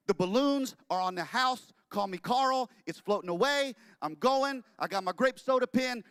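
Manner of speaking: loud, high, wounded protest; hammed-up pleading. Walt begging his wife to like the name. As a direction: beg her not to burst his bubble.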